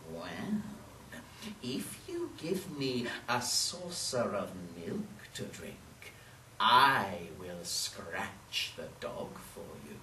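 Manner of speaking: low, soft, unhurried cat voice; bargaining cat. Acted.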